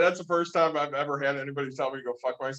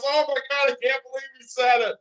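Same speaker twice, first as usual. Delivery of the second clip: a higher, lightly voiced Nick in disbelief; mock Nick freaking out, pitched up; imitating excited Nick; 9 semitones higher than usual. Retelling friend's call, imitating Nick's higher excited disbelief. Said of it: "he goes".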